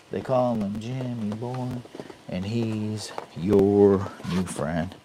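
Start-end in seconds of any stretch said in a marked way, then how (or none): 0.1-1.7 quieter, offhand catchphrase; softer repeat. Pretend. Repeating his own nickname to viewers, softly. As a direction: toss it off almost under the breath.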